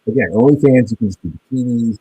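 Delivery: muddy, garbled audio dropout; underwater gurgling voice. Real, not acted. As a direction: sound like a bad connection underwater. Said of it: "you sound like you're underwater".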